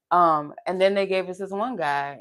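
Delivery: usual speaking voice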